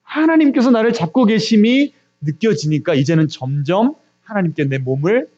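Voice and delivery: normal talking voice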